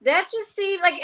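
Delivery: high-pitched mock bewilderment; puzzled foreign contacts. Overseas leaders asking about Australia, high mock puzzlement. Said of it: "they bring it up every time they go".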